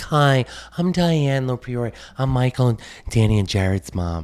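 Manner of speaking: slow, proper introduction in a put-on voice. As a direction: put on a polite suburban mom.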